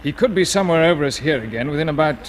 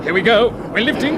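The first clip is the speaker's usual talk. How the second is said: excited liftoff; 7 semitones higher than usual. Acted. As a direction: ride the surge of takeoff. Liftoff, pilot over engine noise.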